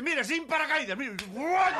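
very high, loud reckless yell; wild bragging cry; defiant shout. Acted. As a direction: leap off crowing defiance. Soldier jumps defiantly.

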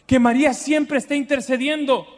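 ordinary talk

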